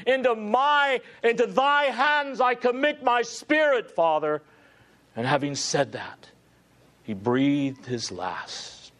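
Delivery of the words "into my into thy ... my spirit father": great final cry. Retold or imitated. Preacher voicing the dying Christ's cry to the Father. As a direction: pour the last strength into the cry. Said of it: "he musters one great final cry".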